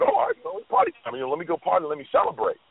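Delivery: high, giddy party voice; party excitement. Retold or imitated. [name] imitating himself ready to party, high and giddy. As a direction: hype it up like it's party time. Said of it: "he said when he got the nomination".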